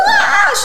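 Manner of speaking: sharp high mock scream, loud; a quick imitated scream; retold scream. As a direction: let out a shrill little retold shriek. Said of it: "she screamed".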